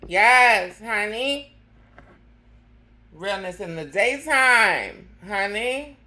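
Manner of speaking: loud cheer. Real, not acted. Drag host cheering.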